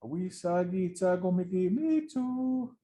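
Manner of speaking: high, slow bird voice calling out. Acted. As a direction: sing it out like a chattering bird. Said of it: "that bird yells out".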